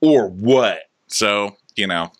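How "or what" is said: challenging. Retold or imitated. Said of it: "And goes".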